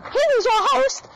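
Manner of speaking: high, showy MC announcement; announcer voice. Pretend. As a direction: announce like a game show host. Announcing the host.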